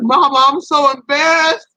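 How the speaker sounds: high, whiny mock-tearful plea; mock crying. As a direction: act out crying to mama, embarrassed. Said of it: "I'm really crying 'cause I'm embarrassed".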